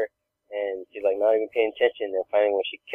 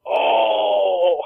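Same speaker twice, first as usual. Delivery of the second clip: loud, higher, drawn-out "oh"; imitated pained wail; 8 semitones higher, 4 dB louder than usual. Daughter comes out rubbing her head, mock wail. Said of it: "all rubbing her head".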